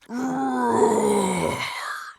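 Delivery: a long, high, wavering sigh. A narrator's reading. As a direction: sigh it out like a sad little cub. Cub feels upset, long sigh.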